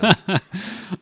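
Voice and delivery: light high-pitched laugh; high giggle. Real, not acted. Laughing at tangent.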